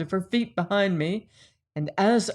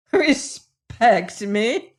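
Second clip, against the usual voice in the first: higher, louder, very drawn-out incredulous question; wild cry; 4 semitones higher, 5 dB louder, much slower than usual. A narrator's reading. Joanna flinging his word back at him, wild cry. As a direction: throw it back slowly with a sharp rise. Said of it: "cried she with a strange wild laugh".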